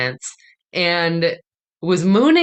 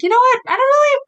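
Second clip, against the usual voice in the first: high-pitched, louder mock-casual quote; blithe retold confidence; 5 dB louder, much faster than usual. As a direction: imitate yourselves being naively breezy, pitched way up. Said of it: "both of us were like".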